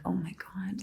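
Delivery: hushed, whispered aside; retold whisper of dismay; whispered dread. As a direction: say it under your breath, barely voiced. Dreading the effort page, in session.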